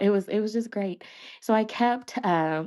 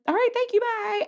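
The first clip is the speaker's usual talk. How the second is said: high-pitched hammed-up sign-off; flustered quick goodbye. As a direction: blurt a mock-panicked farewell, way up high. Acts out fleeing after her speech.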